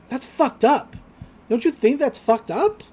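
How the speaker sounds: mock outrage, pushing the question; Ryoko's outrage. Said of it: "she's like".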